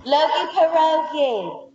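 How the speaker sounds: put-on slow, high Brooklyn accent. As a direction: drawl it out Brooklyn style. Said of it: "you did it in, like, a Brooklyn accent".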